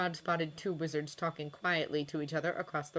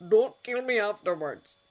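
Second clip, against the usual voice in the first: louder plea, only half in jest; half-serious warning, voice louder; half joking; 3 semitones higher, 6 dB louder than usual. A narrator's reading. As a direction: joke with real worry under it. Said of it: "only half joking".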